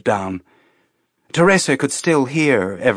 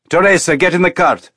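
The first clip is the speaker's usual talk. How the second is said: high, loud and fast command; loud order; 7 semitones higher, 5 dB louder, faster than usual. A narrator's reading. Colonel ordering Teresa into the cart, loud and fast. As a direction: order her in without patience.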